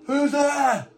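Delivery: high, loud cry into the dark; high-pitched, urgent challenge; calling prisoner. Acted. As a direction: call out anxiously from a cell. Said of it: "Looking out, going".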